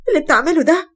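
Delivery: high, loud frantic begging; desperate screaming plea. Acted. Wife screaming at her husband to stop beating the dog. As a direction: cry out to stop him hurting the dog.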